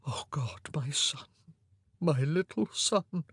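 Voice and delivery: broken whisper, slow at the end; murmured brokenly. A narrator's reading. King holding his dead son, broken whisper. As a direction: grief barely voiced. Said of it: "he murmured brokenly".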